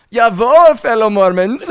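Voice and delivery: high, loud mock German accent. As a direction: belt it out in comic German accent. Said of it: "I wanna hear your German accent now".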